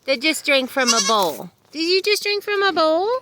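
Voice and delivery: high, loud sing-song pet praise. Real, not acted. Cooing praise to an animal.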